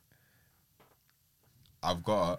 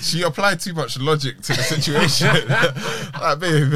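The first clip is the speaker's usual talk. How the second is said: laughing; 9 semitones higher, 9 dB louder than usual. Real, not acted. Laughing through the story, laughs on these lines.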